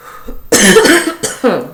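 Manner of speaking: long hacking cough. Real, not acted. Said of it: "I about coughed out a lung".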